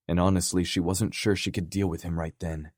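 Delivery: ordinary delivery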